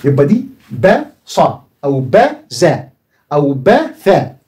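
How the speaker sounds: slow, spelled-out "با صا أو با زا", each syllable drawn out; slow teacher's syllable demo; sounding out syllables. Shown as a demonstration. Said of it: "بالكلام اللي أنت بتنطقه حاليًا".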